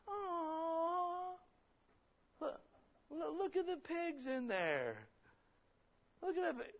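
put-on very high, delighted girl's voice cooing at pigs; imitating excited daughters. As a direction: squeal it like a little girl spotting pigs from the car. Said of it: "especially when they're going".

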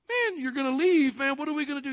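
high, loud protesting outburst; acted alarm. Preacher as disciples hearing Jesus will leave, acted alarm. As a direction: cry out in hammed-up dismay.

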